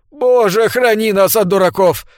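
high, strained, loud exclamation; wheezed. A narrator's reading. Sir Andrew curses the fools, straining hoarsely. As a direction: force out the prayer in exasperation. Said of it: "просипел сэр Эндрю".

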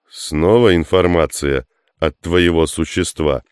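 usual speaking voice

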